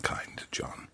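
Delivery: soft breathy aside to the audience; whispered aside. A narrator's reading. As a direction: whisper it like a secret.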